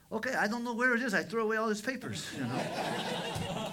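high, loud, fast imitation; flustered reply. Father answering his daughter about the church, loudly flustered.

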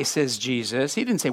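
usual speaking voice

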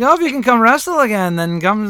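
put-on half-laughing stab at Becky's accent; Becky's accent, attempted. Co-host mimics Becky taunting Edge, a rough stab at her accent. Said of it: "I can't do her accent".

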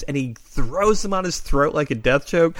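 normal talking voice

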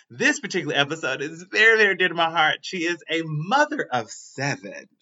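usual speaking voice

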